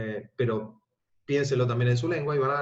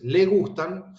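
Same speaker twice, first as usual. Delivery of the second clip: "le" light and "gustan" carrying the stress, louder; unstressed le demo; 5 semitones higher, 5 dB louder, much slower than usual. Shown as a demonstration. Teacher shows students the pronoun "le" is unstressed. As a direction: lean on "gustan", barely touch "le". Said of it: "fíjense cómo se nota que no, no tiene acento".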